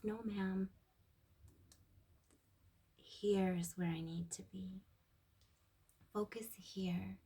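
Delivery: slow, soft and steady, dropping to a whisper; gently steer yourself back; quiet grounding reminder to oneself; calm firm self-talk. Retold or imitated.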